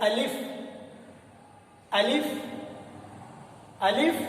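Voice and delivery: अलिफ said with care, no sound stretched; lovingly said alif. Shown as a demonstration. Teacher modelling for learners to copy, अलिफ said lovingly, unstretched. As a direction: say अलिफ gently measured, rising at the end. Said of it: "बड़े ही प्यार के साथ".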